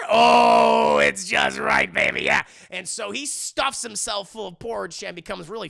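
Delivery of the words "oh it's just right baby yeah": shouted slow delight, much louder; hammed-up ecstatic groan; loud blissful oh. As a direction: savor it like heaven. Chair just right, blissful.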